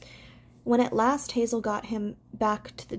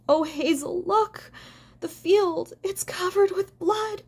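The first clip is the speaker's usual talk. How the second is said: terrified cry; 8 semitones higher than usual. A narrator's reading. Fiver sees the field covered with blood, terrified cry. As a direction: cry out in horror at what only you can see.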